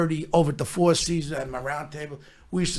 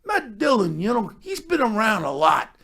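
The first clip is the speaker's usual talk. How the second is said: pitched-up imitation, easing down as it goes; Sly impression; 7 semitones higher than usual. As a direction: mimic a star handing out sly advice. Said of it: "He goes".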